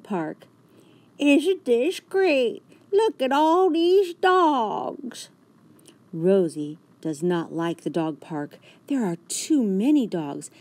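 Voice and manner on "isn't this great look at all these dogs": slow, high, loud enthusiasm; excited. A narrator's reading. George shows Rosie the dog park, excited. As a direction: gush over the dog park.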